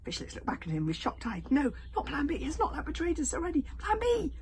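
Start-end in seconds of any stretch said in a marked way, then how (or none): 1.5-3.6 high loud panicky pleading; frightened protest. Acted. 3.8-4.3 firm insistence. Acted. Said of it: "Hiccup repeated".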